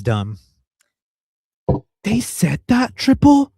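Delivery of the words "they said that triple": high, sarcastic disbelief; mock surprise. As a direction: play it up as shocked. Sarcastic shock at caller.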